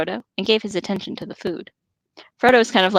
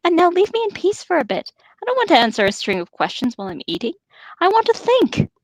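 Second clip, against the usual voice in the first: sharp; 10 semitones higher than usual. Acted. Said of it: "said Frodo sharply".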